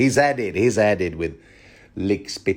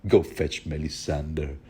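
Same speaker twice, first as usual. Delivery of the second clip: softer, slow grumbling under the breath; cartoonish mumble; 4 semitones lower, 6 dB quieter, slower than usual. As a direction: mutter off as he leaves. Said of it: "cartoonishly mumbling to himself".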